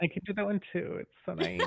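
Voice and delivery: put-on high, airy Valley Girl accent. Shown as a demonstration. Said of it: "Valley Girl accent".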